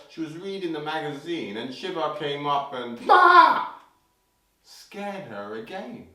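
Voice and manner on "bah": loud, high burst; sudden scare shout. Acted. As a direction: leap out with a "Bah!". Shiva scares Parvati a third time.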